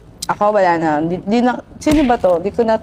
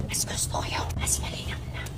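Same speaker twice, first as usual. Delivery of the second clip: soft unvoiced whisper; 16 dB quieter than usual. Real, not acted. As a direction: whisper it close to the mic. Whispered aside while eating.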